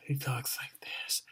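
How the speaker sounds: creepy, voiceless whisper; demonic dog whisper. Acted. Reviewer imitating the movie's demonic dog, creepy whisper. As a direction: whisper it like an inhuman hellhound. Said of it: "he's whispering, but no, no, it's worse".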